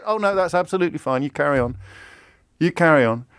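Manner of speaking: quick, softening climb-down; instantly placated. Acted. Supporter excuses everything once Brexit is mentioned, instantly placated. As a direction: drop the outrage at once and wave it through.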